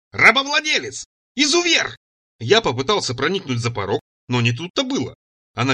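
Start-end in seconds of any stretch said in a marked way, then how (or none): 0.1-2.0 high-pitched outraged name-calling; enraged tirade. A narrator's reading. Цира rails at him from the doorway, enraged. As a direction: hurl each insult up high like an accusation. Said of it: "прямо с порога понесла".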